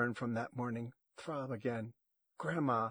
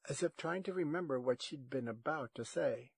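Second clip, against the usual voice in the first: plain reading voice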